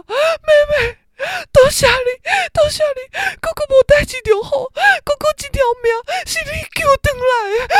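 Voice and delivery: imitating mom crying in a high wail; mock sobbing mother. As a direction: wail it like a sobbing mother clinging to her daughter. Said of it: "大哭就说".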